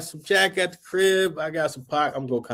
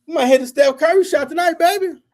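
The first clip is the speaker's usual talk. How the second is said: much higher, hammed-up smooth flirting; mock flirty dude; 4 dB louder than usual. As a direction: imitate a guy hitting on her. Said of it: "I'm a dude like".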